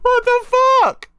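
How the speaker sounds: loud, slow, disbelieving high cry; real shocked yell; stunned outburst. Real, not acted. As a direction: blurt it out in pure shock. Stunned by the manga chapter.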